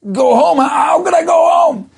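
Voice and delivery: high, loud, disbelieving protest; incredulous outburst. Retold or imitated. Frightened merchant answering his Rebbe's order, incredulous outburst. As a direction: shout it back in acted panic and disbelief.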